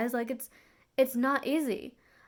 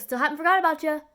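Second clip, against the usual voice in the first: quick, louder offhand line, voice pitched up higher; brushing-off doctor; 5 semitones higher, 7 dB louder, faster than usual. Retold or imitated. Doctor brushing off the waiting patient, quick and loud. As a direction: toss it off fast while walking past. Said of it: "He goes".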